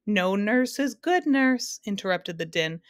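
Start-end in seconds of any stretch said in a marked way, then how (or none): 0.0-1.8 slow, higher-pitched jeer at one's own joke; mildly hammed-up hilarity; gleeful interruption. A narrator's reading. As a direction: butt in gleefully, drawing out each word. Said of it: "doubling with laughter".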